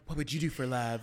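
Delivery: low perfume-ad voice; Natalie Portman. Retold or imitated. Mocking a perfume ad to a co-host, low dramatic line. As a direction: lightly mimic an actress in a fragrance commercial. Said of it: "Natalie Portman, like, running through a field".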